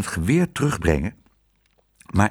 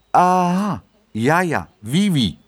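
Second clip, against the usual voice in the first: higher, louder dawning understanding; knowing aha; 7 semitones higher, 3 dB louder, slower than usual. A narrator's reading. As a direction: exclaim as if you follow it all. Feigns understanding the French guests.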